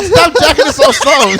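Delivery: high, loud, laughing command; mock yell. To the sleepy woman, in a high, loud laughing yell. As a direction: bark it up high through a laugh. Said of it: "I'm like".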